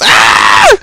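sudden, very loud scream; mock scream. Acts out a character screaming.

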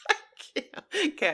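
breaking down into giggles; helpless, laugh-broken fragments; crying with laughter. Real, not acted. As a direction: lose the words to real laughter. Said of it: "crying, laughing".

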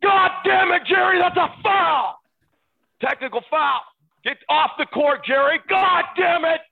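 high, loud, outraged holler; courtside yelling impression. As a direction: scream the foul across the court.

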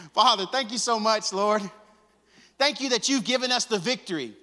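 high, loud preaching prayer; shouted thanksgiving prayer to the Father; loud closing prayer. Acted. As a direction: pray it out over the church. Closing prayer at full force.

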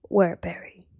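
hushed simple bear voice; whispering bear voice. Acted.

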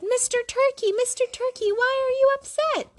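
put-on high, concerned child voice; high kid voice. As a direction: call out to a friend. Narrator voices the concerned kids.

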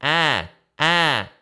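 bright whiny ah, loud; whiny twang. Shown as a demonstration. Coach demonstrating twang for students. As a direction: sing a twangy ah. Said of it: "back to the twang sound".

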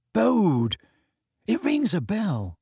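lightly voiced teenage boy, puzzled; a hint of Ron, thinking aloud. A narrator's reading.

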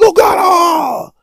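pain scream. Acted. Waking in cramp pain.

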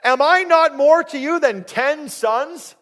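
slow, loud, high-pitched comic plea; clueless, self-important husband. Acted. Husband asking sad wife if he's enough, incredulous. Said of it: "And he's like".

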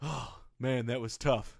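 low, soft sigh of relief and regret; drained regret. Acted. Boss alone after firing his coach, quiet and drained. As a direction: drop down quiet after the shouting.

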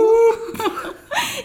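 high laugh breaking into the reading; burst of laughter. Real, not acted. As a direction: laugh out loud mid-sentence. Laughs mid reading a rude quote.

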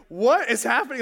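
mock freak-out, voice jumping up loud. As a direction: act out a moment of alarm. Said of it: "so I instantly freak out".

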